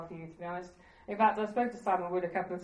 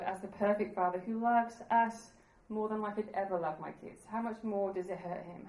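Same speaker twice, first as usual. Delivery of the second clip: normal talking voice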